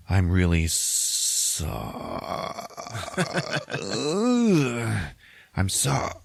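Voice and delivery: slow, choking on the word; mock agony, stretching an unfinished word into a groan; struggling to apologize. Batman struggles to say sorry.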